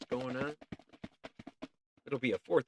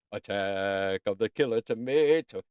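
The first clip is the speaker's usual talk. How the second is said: mock film announcer; 4 semitones lower than usual.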